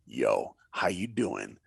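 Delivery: lightly voiced superstar greeting a kid; deep Jordan greeting. Jordan looks down at a fan and says hi, deep and casual. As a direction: drop way low for a cool, laid-back "Yo".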